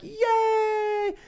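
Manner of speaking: a very high cheer; cheering crowd. Acted. Pitching the movie, the townsfolk cheering. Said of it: "And then everyone's like".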